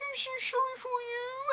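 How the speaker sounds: high, soft pitying voice; syrupy sympathy. Retold or imitated. Passive-aggressive stranger pitying her, high soft voice. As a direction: drip with fake sympathy. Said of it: "passive-aggressive types".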